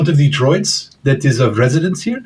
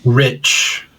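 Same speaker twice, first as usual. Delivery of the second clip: usual speaking voice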